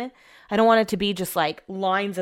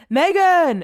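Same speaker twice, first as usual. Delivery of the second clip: loud, much higher call of a name; calling up the stairs; 11 semitones higher, 8 dB louder than usual. Retold or imitated. Husband yelling up to her from downstairs, loud high call. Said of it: "Brett keeps yelling up".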